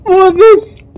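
high, loud frantic yelling; panicking gamer. Real, not acted. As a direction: shout in real panic. Animatronic chasing him in game.

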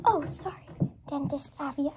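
ordinary delivery

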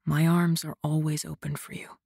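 low, soft murmur; near-whisper, close to her ear. A narrator's reading. Man holding her close, whispering low. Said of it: "he whispered".

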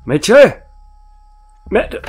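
loud, rising call into the dark house. Acted. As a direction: call out for him, hoping. Calling into the house. (lautes Rufen ins Haus)

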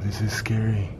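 soft, hushed frightened whisper; scared whisper. Real, not acted. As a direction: whisper it, spooked. Frightened in the attic.

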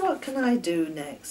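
ordinary delivery

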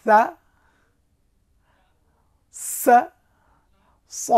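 সা light, সা with a hiss, স heavy and full; letter drill. Shown as a demonstration. Teacher drills Arabic letters for students, loud and clear. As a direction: say সা, সা, স as three distinct letters. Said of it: "আমার সঙ্গে একটু practice করুন".

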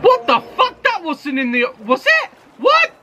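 shocked. Real, not acted. Shocked at witch eaten.

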